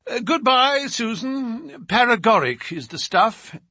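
high, breezy goodbye; lively send-off. A narrator's reading. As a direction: call a cheery farewell after the departing servant. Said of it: "turning suddenly from lively to severe".

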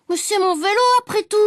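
put-on high, aggrieved little-boy complaint; indignant small boy sticking up for himself; protesting child. A narrator's reading. Child protests about his bike.

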